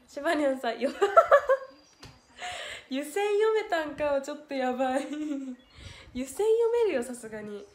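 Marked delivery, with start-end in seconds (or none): 1.0-1.7 a sudden high shriek of laughter, much louder. Real, not acted. Reading a viewer's teasing comment, shrieking laugh. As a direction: burst out in a piercing laugh.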